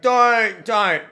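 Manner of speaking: loud, high cry of protest; imitated pleading sister. Sister trying to stop her brother, imitated loud high plea. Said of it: "Megan's like".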